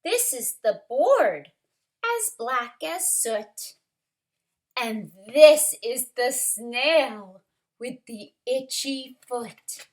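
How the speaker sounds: lively rhyming picture-book reading, lifted stresses; story narration. A narrator's reading. Teacher reads rhyming story to kindergarteners, lively narration. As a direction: punch the key word of each phrase.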